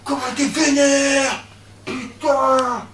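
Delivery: high, slow, stretched exclamation; amazed outburst. Acted. Exclaims at the guard's anger.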